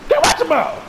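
loud, very high warning yell; urgent shout. Real, not acted. Warning his partner of danger, yelled high. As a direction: scream the warning fast.

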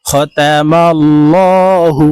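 খতামাল্লাহু chanted slowly with a heavy 'law'; মোটা লাম. Shown as a demonstration. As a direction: draw out খতামাল্লাহু, thick L before the final 'hu'. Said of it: "ল বলে অর্থাৎ মোটা করে পড়ব". (খতামাল্লাহু-তে লাম মোটা করে ধীরে পড়া)

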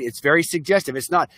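ordinary talk, nothing marked

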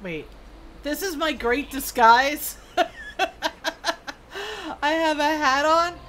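ordinary talk

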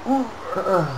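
high, soft "uh" noises; excited hooting. Real, not acted. Gamer reacting to blows in the match, excited hooting.

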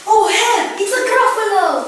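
high, loud cry for help; mock terror. Mouse seeing the Gruffalo, high and loud panic. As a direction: yelp it out as if caught.

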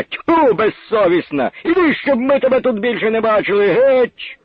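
high, jeering crowd shouts; hostile heckling. Acted. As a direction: drive her off with scorn. Crowd heckling woman on stage.

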